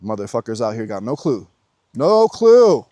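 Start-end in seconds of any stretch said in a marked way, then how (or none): 1.9-2.9 high, loud, drawn-out outburst; emphatic exclamation. Real, not acted. Vlogger on people who have no idea, loudly emphatic. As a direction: belt it out in disbelief.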